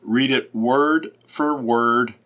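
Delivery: slow, measured, word by word; deliberate reading of a resolution. Shown as a demonstration. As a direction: give every word its own weight. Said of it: "Do not rush through it".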